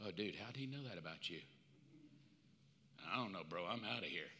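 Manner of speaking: low, soft, quick retreat; hushed accuser sneaking off; hushed panic. Acted. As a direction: mutter and slip away. Accuser flees after name written.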